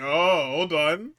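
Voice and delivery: slow, drawn-out dramatic oh hold on; sitcom suspense. Retold or imitated. Host mimicking the whole crowd in a sitcom moment, slow drawn suspense. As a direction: stretch it like a sitcom cliffhanger. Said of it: "cue sitcom rising tension".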